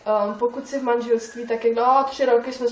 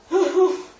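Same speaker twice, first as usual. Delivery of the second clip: loud burst of laughter; 8 semitones higher, 7 dB louder than usual. Real, not acted. Laughs at own joke.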